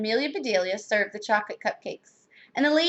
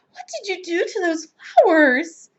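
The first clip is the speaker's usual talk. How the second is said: high, appalled demand; dismayed cry; 7 semitones higher, 4 dB louder than usual. A narrator's reading. Missus Rogers to maid over flowers, crying out. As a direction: wail the question at the maid. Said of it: "she cried".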